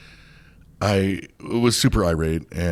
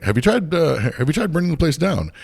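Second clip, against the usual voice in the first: higher, deadpan sarcastic quip; sarcastic joke; 9 semitones higher, 4 dB louder than usual. Retold or imitated. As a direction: toss off the joke with mock innocence.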